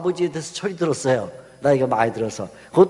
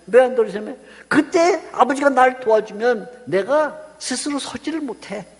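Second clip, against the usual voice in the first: ordinary delivery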